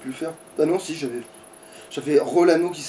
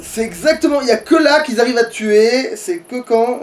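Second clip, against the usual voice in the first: frustrated complaint; 8 semitones higher, 5 dB louder than usual. Real, not acted. Complains about the deaths.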